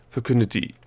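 ordinary delivery, nothing marked

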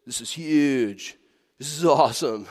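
a hint of a smug man bragging; boastful guy. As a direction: show off a little hole like a wonder. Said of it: "he turns to people and he goes".

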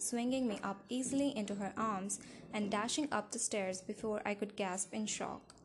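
ordinary delivery, nothing marked

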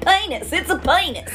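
high, loud Southern twang; Southern accent. Shown as a demonstration. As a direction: draw out PENIS as PAY-nis, Southern style. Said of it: "say it with a Southern accent".

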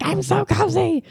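high, slow dreamy sigh; blissful cozy. Retold or imitated. Teen imitating herself snuggled cozy in bed. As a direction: melt into the covers.

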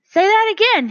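high, loud sudden "say that again"; jolted upright, demanding to hear it; sharp astonished call; startled demand. A narrator's reading. Stuart startled by the song.

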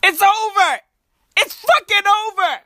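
high-pitched, hammed-up despair; mock panic. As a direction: wail it high as if all is lost. Acted panic after the sneeze.

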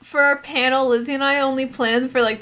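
ordinary talk, nothing marked

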